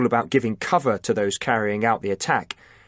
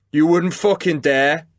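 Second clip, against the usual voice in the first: sneering; 6 semitones higher, 4 dB louder than usual. A narrator's reading. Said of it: "Chris sneered".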